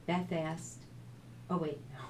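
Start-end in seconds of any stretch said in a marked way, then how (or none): none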